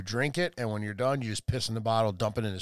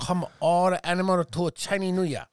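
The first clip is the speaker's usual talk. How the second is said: put-on caricatured Chinese accent; hammed-up emperor in a put-on Chinese accent; mock Chinese accent; 3 semitones higher than usual. Emperor summoning the animals, mock Chinese accent. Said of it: "can you do a Chinese accent?".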